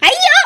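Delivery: loud, high cry of frustration; exasperated cry. Acted. Elder sister cries out in frustration at her sister. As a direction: let out a fed-up wail.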